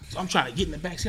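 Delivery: normal talking voice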